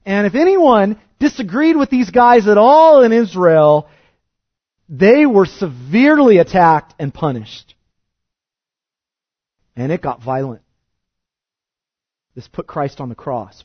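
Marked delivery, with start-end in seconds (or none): 0.1-7.7 louder, higher preaching at full force; indignant preaching. Real, not acted. Preacher denouncing the persecutors of Israel, loud and indignant.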